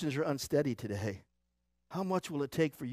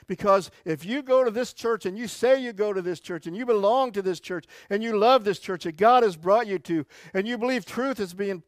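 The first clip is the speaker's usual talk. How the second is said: rising, driving preacher's build; preaching at full force; 6 semitones higher, 9 dB louder than usual. Acted. Pastor warning his church, preaching at full force. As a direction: push it out to the whole congregation.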